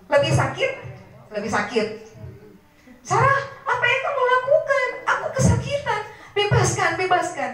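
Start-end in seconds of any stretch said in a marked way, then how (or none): 3.0-7.5 high, fast cries of pain and begging; frantic pained plea. Acted. King in worse pain begging Sarah, high frantic cry. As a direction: scream for mercy, hurting.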